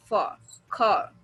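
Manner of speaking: फार, खार stretched slowly with the R dropped; drawn-out फार and खार, no R at the end; no R sound. Shown as a demonstration. Teacher slowly drilling फार and खार without R. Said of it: "आर नहीं बोलते हैं".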